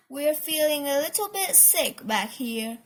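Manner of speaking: ordinary delivery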